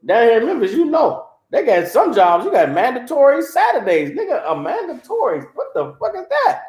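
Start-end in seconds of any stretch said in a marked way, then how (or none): 4.1-6.6 high, incredulous put-on voice, laughing through it; indignant worker. Worker shocked by mandatory Saturday work, high incredulous voice. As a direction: play the shocked worker who thought he had Saturdays off.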